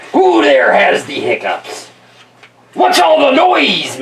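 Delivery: put-on slow, high-pitched pirate accent. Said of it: "so he has this accent".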